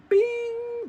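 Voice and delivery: high ding imitation; high-pitched bell sound; elevator ding. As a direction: sing out a sharp elevator bing. Elevator doors opening.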